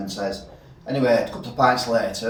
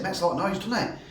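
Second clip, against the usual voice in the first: barman voice; 7 semitones higher, 6 dB quieter, faster than usual. Retold or imitated. Barman voiced in pub joke.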